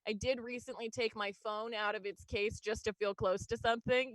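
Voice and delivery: normal talking voice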